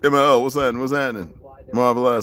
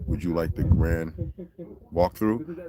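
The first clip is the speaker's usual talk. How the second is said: lower, prim guide voice, drawn out; mimicking a polite guide; 6 semitones lower, 6 dB quieter, slower than usual. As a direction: repeat the guide's offer in a lightly put-on formal tone. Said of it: "this is what he said".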